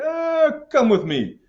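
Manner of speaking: loud, high grab-them cry; mock press-gang seizure. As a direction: play a navy officer snatching sailors. Acts out navy seizing sailors.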